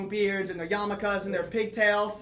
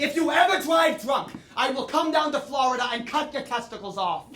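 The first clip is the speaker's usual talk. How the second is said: loud mock shouted threat; grandpa's threat; 4 semitones higher, 6 dB louder than usual. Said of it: "He also once told me".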